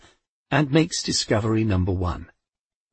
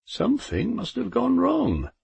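nervous Death; 14 semitones higher than usual. A narrator's reading. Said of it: "cleared his throat nervously".

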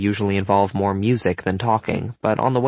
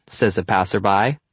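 usual speaking voice

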